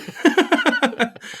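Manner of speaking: a high burst of real laughter. Real, not acted. Laughing at own joke.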